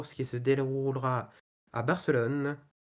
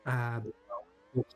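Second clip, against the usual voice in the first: ordinary talk, nothing marked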